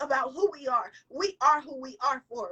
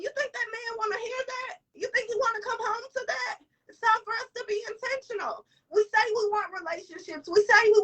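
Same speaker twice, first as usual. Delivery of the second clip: high, pointed challenge; emphatic; 7 semitones higher than usual. Real, not acted. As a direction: push the question home. Rhetorical challenge to audience.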